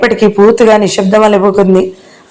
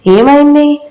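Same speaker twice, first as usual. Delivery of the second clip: higher, sudden worried question; alarmed; 6 semitones higher, slower than usual. A narrator's reading. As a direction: pitch jumps up in sudden alarm. Medha alarmed at uncle's news.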